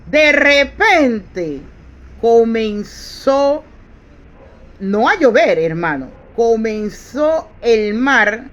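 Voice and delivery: slow, loud and drawn out, building the storm; dramatic preaching. Acted. Preacher telling the congregation of the storm at sea, slow and loud. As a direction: stretch every word like waves rising.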